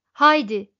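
loud one-word shout; narrated loud command; shouted urging. A narrator's reading. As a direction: call it out sharply, well above talking level. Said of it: "diye bağırdı yaşça büyük olanı".

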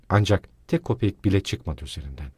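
plain reading voice

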